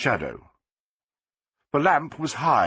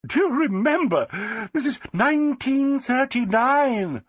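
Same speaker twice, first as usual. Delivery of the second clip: high, gushing society lady; Flossie, delighted; 12 semitones higher, slower than usual. A narrator's reading. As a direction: crow with triumph, slowing at the end. Flossie teasing, slow.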